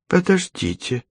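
put-on high, hushed old woman's call; alarmed grandmother. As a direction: stop her, suddenly worried.

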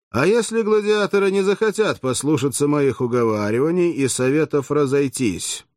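ordinary delivery

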